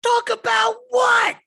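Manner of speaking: very high, loud, slow shriek; goblin shout. Acted. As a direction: screech the question like an exasperated boss. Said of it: "And she goes".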